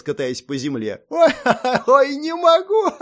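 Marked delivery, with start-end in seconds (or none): 1.1-3.0 high, loud hysterical laughing; shrieking, helpless guffaws through the words; roaring with laughter. A narrator's reading. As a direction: laugh so hard the words barely come out. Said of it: "заржала в голос".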